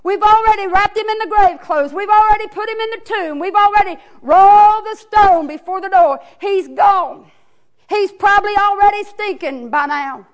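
plead, then shout in distress; the sisters wailing at Jesus; grieving family. Acted. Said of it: "Lazarus' family runs out to him and says".

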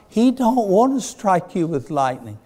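full-force pulpit delivery; preaching. Acted. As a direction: preach it out to the congregation.

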